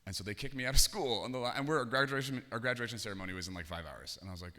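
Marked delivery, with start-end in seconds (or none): none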